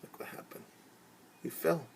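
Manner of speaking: normal talking voice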